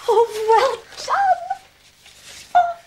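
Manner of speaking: overjoyed. Real, not acted. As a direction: cry out in joy. Mother reads scholarship pass.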